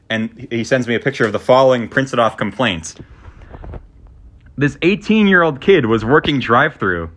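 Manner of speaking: ordinary delivery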